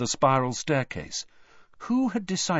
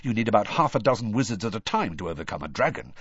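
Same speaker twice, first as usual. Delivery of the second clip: quickly; faster than usual. Acted. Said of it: "speaking very quickly now".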